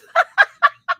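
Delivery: high, loud cackle; high laugh burst. Real, not acted. After the husband's drugs remark, high burst of laughter.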